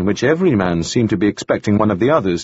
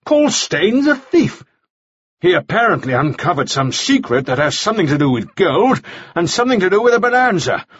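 higher-pitched, heated accusation; angry rant; 5 semitones higher than usual. A narrator's reading. Mannering rages at Charlie Frost, angry rant. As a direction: build the case in exasperation.

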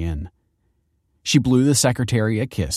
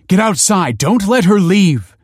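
high, loud alarmed shout; urgent shouted order; 11 semitones higher, 8 dB louder than usual. A narrator's reading. Principal rushing in as pupil escapes, shouting in alarm.

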